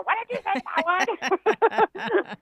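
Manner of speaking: high-pitched, hammed-up outraged caller; mock complaint. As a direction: squeal it like a mock-indignant caller. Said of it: "don't be yelling at me".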